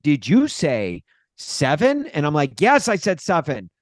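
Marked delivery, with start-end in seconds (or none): 0.0-2.1 slow, drawn-out computer prompt; automated phone computer. Retold or imitated. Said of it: "talk to the computers". 2.6-3.7 higher, mock-irritated retort; exasperated reply. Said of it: "I hate talking to computers".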